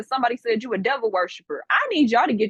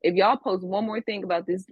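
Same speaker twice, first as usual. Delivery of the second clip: usual speaking voice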